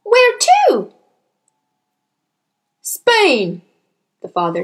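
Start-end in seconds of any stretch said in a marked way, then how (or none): 0.0-0.9 put-on high, loud child's question; startled little-girl yelp; crying out. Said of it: "Matilda cried out". 2.8-3.6 loud, falling one-word answer; gruffly busy dad, raised voice; curt father. Acted.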